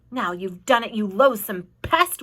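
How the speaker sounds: ordinary delivery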